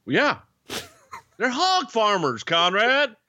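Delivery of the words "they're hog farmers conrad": high-pitched put-on character voice; comic high-pitched hog farmer pitch; hammed-up mock outburst. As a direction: squeal it in mock outrage, far above your usual pitch.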